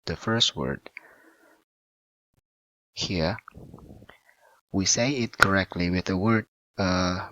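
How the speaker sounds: usual speaking voice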